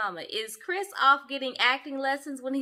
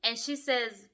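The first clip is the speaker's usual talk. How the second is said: usual speaking voice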